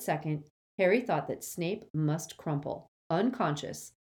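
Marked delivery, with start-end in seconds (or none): none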